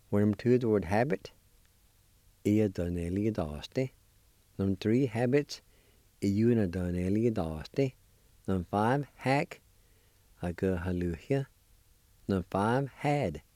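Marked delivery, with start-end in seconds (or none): 2.5-3.9 teacher review of ia donnelli daste. Shown as a demonstration. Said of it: "I'll pronounce these words one more time". 6.2-7.9 teacher review of iuna donnelli daste. Shown as a demonstration. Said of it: "I'll pronounce these words one more time". 10.4-11.5 teacher review of aga haluhia. Shown as a demonstration. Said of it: "I'll pronounce these words one more time".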